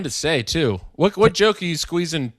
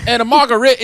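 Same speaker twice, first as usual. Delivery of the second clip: louder, higher Southern margarita order; hammed-up Southern accent; 7 semitones higher, 5 dB louder, faster than usual. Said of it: "you go full hick".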